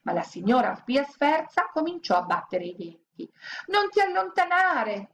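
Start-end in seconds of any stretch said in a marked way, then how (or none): 3.7-5.1 high, pleading command; cling with panic in the voice; frightened order. A narrator's reading. Scared woman ordering in the graveyard, high and anxious. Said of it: "ordinò".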